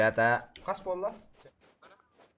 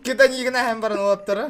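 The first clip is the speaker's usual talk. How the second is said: talking through loud laughter; laughing; 7 semitones higher, 6 dB louder, faster than usual. Real, not acted. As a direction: burst out laughing mid-sentence. Laughing at teammate.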